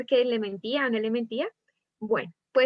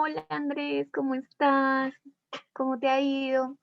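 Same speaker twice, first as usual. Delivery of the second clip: flirty; 2 semitones higher than usual. Shown as a demonstration. Teacher demonstrating a tone to the class, flirty. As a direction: linger sweetly on each word to flirt. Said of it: "Que uno suena más coqueto".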